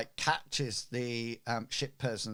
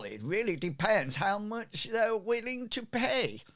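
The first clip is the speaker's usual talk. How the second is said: much higher, indignant merchant; flustered merchant; 10 semitones higher, 4 dB louder than usual. Acted. Merchant protesting to the ship's man, high and indignant. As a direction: squeak out outraged disbelief. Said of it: "the merchant gets quite flustered".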